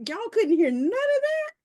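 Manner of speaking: you can't be serious, nobody heard it?; incredulous. Real, not acted. Host to guests, amazed they missed the barking, incredulous.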